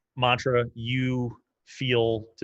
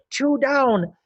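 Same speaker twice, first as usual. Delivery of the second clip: high, loud, slow gloating goblin voice; excited goblin; 6 dB louder, slower than usual. Acted. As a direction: savour each number of the countdown with glee. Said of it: "He's excited".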